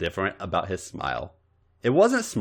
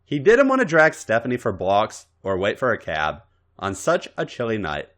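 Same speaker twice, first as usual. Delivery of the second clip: ordinary delivery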